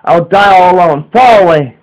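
high, loud despairing cry; a desperate shout. A narrator's reading. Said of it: "face and shouts".

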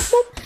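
high cartoon boop; boop sound effect. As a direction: pop out a high little boop. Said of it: "jumped on that man's head and went".

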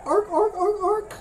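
high, short seal barks. Pretend. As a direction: bark "ark" high like a seal. Quiz on seal sound.

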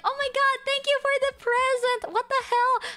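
bubbling over with surprise; retold excited squeal. Streamer acting out thanking a fan for a gift, high and gushing. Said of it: "and I was like".

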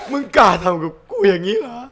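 high, loud mock outrage; indignant. Gamer confronting the titan that struck, indignant. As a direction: raise the voice in indignant challenge.

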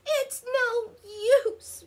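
high-voiced giving up; sighing complaint. A narrator's reading. Ricky gives up on rolling, high sighing lament. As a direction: sigh it out in a high character voice. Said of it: "Ricky sighed".

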